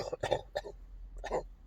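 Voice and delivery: real hacking cough; deep rattling chest cough; chesty cough. Real, not acted.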